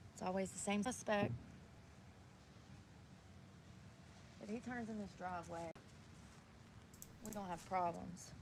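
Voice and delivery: loud, heated venting about the creep; angry rant. Real, not acted. Woman alone in a park venting at a creepy driver, loud and angry. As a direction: blast the creep out loud, fed up.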